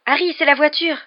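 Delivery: loud, high, excited exclamation; ringing, relieved shout. A narrator's reading. Ron spotting the car, ringing shout of relief. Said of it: "d'une voix soudain claironnante qui exprimait son soulagement".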